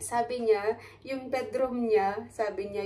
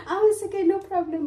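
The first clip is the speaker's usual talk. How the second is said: cheerful reply; 8 semitones higher, 7 dB louder, slower than usual. Retold or imitated. Quoting the old neighbor's cheerful reply.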